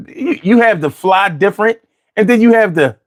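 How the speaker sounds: ordinary talk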